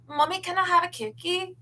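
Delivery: put-on high, cute child asking for a cookie; cute kid with accent. Imagined little son asking mommy, high and cute. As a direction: beg for a cookie like a small child. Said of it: "in that accent".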